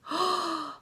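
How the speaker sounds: high, quick intake of breath; storyteller's alarmed gasp; startled gasp. Acted. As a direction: gasp in mock shock. Reacting to the wolf's surprise.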